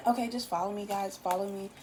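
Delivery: ordinary delivery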